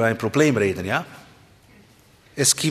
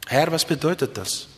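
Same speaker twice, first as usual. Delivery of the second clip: ordinary talk, nothing marked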